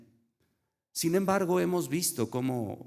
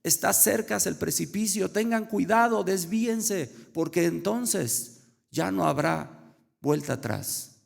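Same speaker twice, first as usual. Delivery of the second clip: put-on high, raised voice calling to the boys; imitated urgent warning; 8 semitones higher, 3 dB louder than usual. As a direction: act out a half-shouted alarm to turn them aside. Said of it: "Una vez más les alertó". (alerta urgente imitada, en voz alta y aguda)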